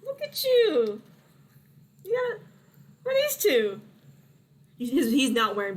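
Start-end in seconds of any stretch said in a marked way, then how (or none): 0.0-3.8 high-pitched, fussing uncle voice; high, excited welcome; delighted uncle. Acted. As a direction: greet a long-lost nephew, pitched way up. Game master voices uncle greeting nephew.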